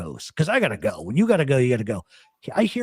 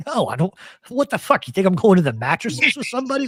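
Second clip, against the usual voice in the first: higher, exasperated "What the fuck?"; incredulous refusal; 6 semitones higher than usual. Retold or imitated. Turning down a friend's offer of guns, incredulous. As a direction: push back, pitch jumping up in disbelief. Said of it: "I was like".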